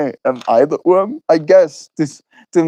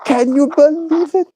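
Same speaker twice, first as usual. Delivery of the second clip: much higher, cartoonish squeal of amazement; exaggerated mock disbelief. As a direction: push the fake shock over the top. Repeats it even higher.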